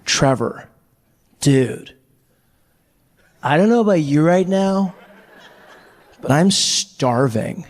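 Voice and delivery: slow, lazy Californian drawl; laid-back dude. Retold or imitated. Friend Dave on his beanbag, lazy drawl. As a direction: drawl it like a chilled-out surfer. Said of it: "he's like".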